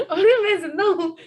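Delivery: laughing through the words; sheepish laugh when caught out. Real, not acted. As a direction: giggle out the excuse.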